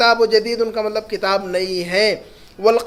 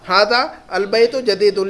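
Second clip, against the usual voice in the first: ordinary talk, nothing marked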